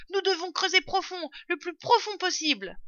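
urgent order. A narrator's reading. Father fox directs the digging family, urgent command. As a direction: drive the team on urgently. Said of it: "ordonna maître Renard".

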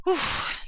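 loud, high puff of relief; relieved sigh. A narrator's reading. Father fox finishes the escape tunnel, sighing in relief. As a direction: blow out an exaggerated "phew".

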